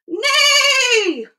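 high, drawn-out neigh; whinny like a horse, falling at the end. A narrator's reading. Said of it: "said a horse".

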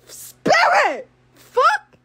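high, loud exasperated yell; loud high burst of exasperation; outraged exclamation. Real, not acted. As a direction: shout the name in disbelief. Exclaiming at the girl's name.

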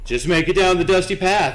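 high, loud sing-song mock of the instructions; mocking the game. Streamer mocking the game's instructions in a high, loud sing-song. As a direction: parrot the game's cheery order sarcastically. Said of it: "That's what the game said".

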